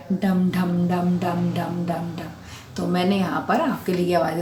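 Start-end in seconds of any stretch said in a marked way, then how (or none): 0.1-2.3 slow, soft rhythmic dum dum beats; damru drum imitation. Shown as a demonstration. Teacher showing the class Shiva's damru, slow rhythmic drumming. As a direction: tap out the drum beats slowly with your voice. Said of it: "डमरू की आवाज़ कैसे बजती है".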